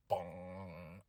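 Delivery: low, soft, drawn-out bong; hushed mimicked gong; imitated bell toll. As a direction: voice a deep funeral bell quietly. Imitates Undertaker's entrance bell.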